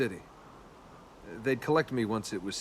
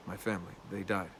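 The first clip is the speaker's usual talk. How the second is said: low, soft and heavy; quiet grief; 5 semitones lower, 6 dB quieter, faster than usual. Acted. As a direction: let the words sink, barely voiced. Quietly admitting family's death.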